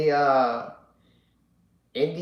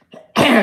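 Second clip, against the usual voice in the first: sharp loud throat clear; 11 semitones higher, 13 dB louder than usual. Real, not acted. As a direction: clear your throat quickly. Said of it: "excuse me".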